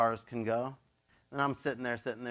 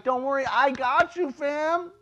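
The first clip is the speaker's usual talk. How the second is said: higher playful voice of God; God reassuring; 10 semitones higher, 3 dB louder, slower than usual. Retold or imitated. Pastor voices God reassuring a giver, high and playful. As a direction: play God casually reassuring you. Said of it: "God's like".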